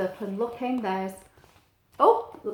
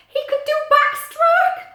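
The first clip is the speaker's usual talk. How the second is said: high, louder delighted cry; excited; 8 dB louder than usual. A narrator's reading. Reader delighting in Pete's swimming. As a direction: burst out with delight.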